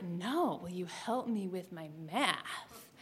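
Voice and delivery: imitating a boy dropping a heavy hint; murmured come-on, drawn out; suggestive repeat. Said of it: "And so he's like".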